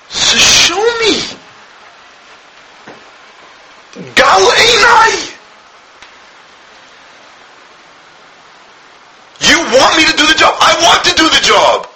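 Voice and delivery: high, pleading cry; crying plea. Acted. King David begging God with a high, tearful cry. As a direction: beg the King with a breaking cry.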